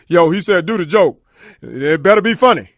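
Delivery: loud, menacing relay of a threat; loud warning. Retold or imitated. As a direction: deliver the threat loudly. Guy relays Tyson's threat.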